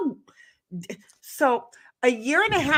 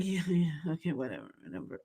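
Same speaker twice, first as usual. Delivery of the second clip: very low, soft brush-off; barely voiced, trailing away; sleepy mumble; 6 semitones lower, 11 dB quieter, much faster than usual. Half-asleep at the door brushing woman off, low mumble. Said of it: "I'm like".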